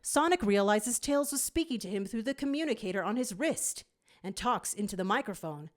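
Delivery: ordinary delivery, nothing marked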